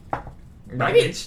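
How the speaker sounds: whispered then squeaked goblin greeting; squeaky goblin sneer. Acted. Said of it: "That's actually how a goblin says good morning".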